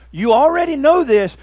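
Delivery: loud preacher's warning to the world; booming angelic warning; angel's warning. Acted. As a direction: proclaim it loudly as the angel. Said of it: "And he says".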